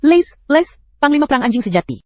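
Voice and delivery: shouting for the dog Lace; calling out. Acted. Calling out a name.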